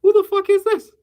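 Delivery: high, rising, disgusted disbelief; baffled fan voice. Pretend. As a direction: react like a confused put-off fan. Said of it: "the fan will be like".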